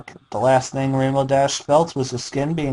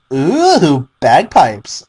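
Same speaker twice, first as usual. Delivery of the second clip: gleeful Pinkie; 3 semitones higher, 6 dB louder, much slower than usual. A narrator's reading. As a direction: squeal it like a delighted child. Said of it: "she said".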